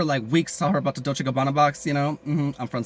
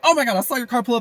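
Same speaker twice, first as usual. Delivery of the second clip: higher, gushing friend voice; imitating excited friend; 8 semitones higher, 4 dB louder, faster than usual. Weed connect greeting her customer, high and gushing. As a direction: gush like an excited girlfriend.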